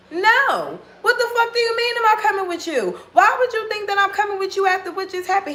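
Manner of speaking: high, fast, outraged "no way"; incredulous outrage. Retold or imitated. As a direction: throw his question back at him, disgusted. Refusing him in the club.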